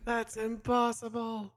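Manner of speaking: high, slow hammed-up incredulity; mock disbelief. Echoing a friend's shock at the resurrection, mock disbelief. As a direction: stretch it out in pretend shock.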